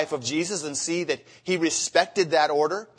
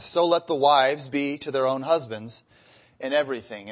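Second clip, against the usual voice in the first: scripture reading; 4 dB louder than usual. Acted. Preacher reads scripture aloud.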